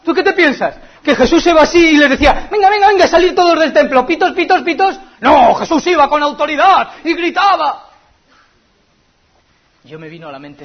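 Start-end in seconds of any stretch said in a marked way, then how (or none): 0.1-7.9 much higher and louder, hammed-up ranting pastor; mock shouting preacher. A pastor mocking the girl's fears, in a loud hammed-up rant. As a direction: parody a yelling charismatic preacher.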